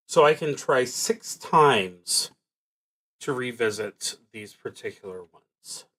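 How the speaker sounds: normal talking voice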